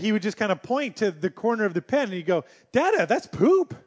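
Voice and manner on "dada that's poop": put-on high, excited little-kid voice; a dad imitating his toddler spotting poop. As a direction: point it out like a thrilled two-year-old. Quoting toddler pointing at poop.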